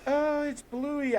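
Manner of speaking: put-on high, slow, drawn-out kid voice; imitating excited kids. Imitating kids in the Disney ad, high and slow. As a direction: stretch it out like a delighted child. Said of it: "the kids are like".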